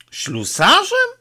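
very high, steeply rising echo; astonished question. A narrator's reading. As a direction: repeat the word in sheer disbelief. Priest astonished by boy's wish. (zdumione pytanie, wysoko w górę)

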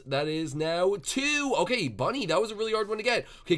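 ordinary delivery

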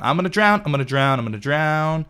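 half-laughing game panic about drowning; playful panic. Real, not acted.

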